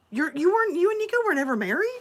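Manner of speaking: high, rushed incredulous question; mock disbelief. Friend learns they were never married, mock incredulous.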